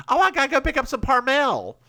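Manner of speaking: high, loud Southern shopper; Southern accent. Acted. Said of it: "say it with a Southern accent".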